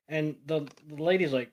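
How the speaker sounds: ordinary delivery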